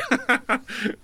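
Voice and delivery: breathy quiet chuckle; amused little laugh; soft laugh. Real, not acted. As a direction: laugh it off softly. Laugh at the remake question.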